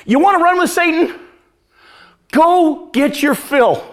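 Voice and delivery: high challenge, then slow go get your fill; high challenge then slowed-down permission; challenging dare. Retold or imitated. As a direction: dare them to go ahead. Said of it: "the church and you say".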